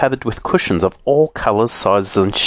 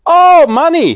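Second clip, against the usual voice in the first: much higher, louder, excited; a squeaky, eager little man; saucepan man voice; 8 dB louder than usual. A narrator's reading. Saucepan man answering Moonface, excited. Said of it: "he said".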